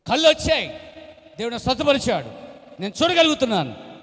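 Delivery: high, loud joyful insisting; insistent shout. Retold or imitated. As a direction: shout it so everyone hears. Blind man insists loudly. (కళ్ళు వచ్చాయని గట్టిగా అరుపు)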